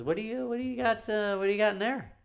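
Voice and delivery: high-pitched inquisitive customer; imitated curious customer. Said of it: "and she goes".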